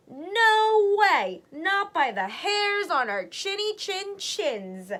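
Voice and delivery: high, loud squeaky piglet cry, slowing; three little pigs defy the wolf, shouting. A narrator's reading. Little pigs refuse the wolf, high and loud. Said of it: "cried the three little pigs".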